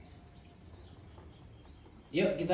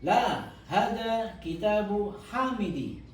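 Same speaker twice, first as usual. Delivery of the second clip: slow, raised model sentence; slow model reading; 4 semitones higher, much slower than usual. Shown as a demonstration. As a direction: spell it out slowly for the class. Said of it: "Saya baca jenengan anu ya". (contoh bacaan lambat dan jelas)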